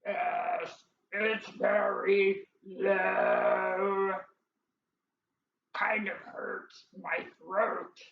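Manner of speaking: very slow, low, strained drone; squeaky character forcing a deep voice. Acted. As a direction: drag each word out low in the throat. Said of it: "it's a little, uh, low".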